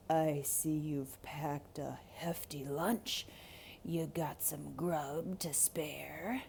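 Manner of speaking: whispered, low and sly wolf, a little slow. Acted. Wolf to Rico, eyeing his lunch, whispered and sly. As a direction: lean in and hiss the question like a hungry wolf. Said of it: "called un lobo".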